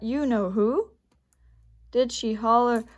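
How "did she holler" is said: ordinary delivery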